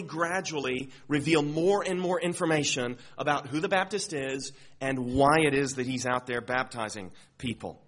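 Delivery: usual speaking voice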